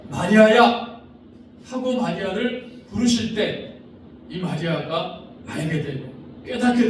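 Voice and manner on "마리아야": a louder call of the name; Jesus calling Mary. Acted. Jesus calls Mary, louder call. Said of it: "예수님께서요".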